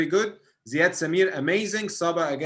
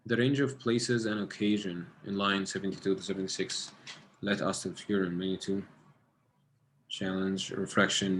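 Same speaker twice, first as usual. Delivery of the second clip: quiet reading to self; 6 semitones lower, 5 dB quieter than usual. Real, not acted. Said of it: "Let me get it again".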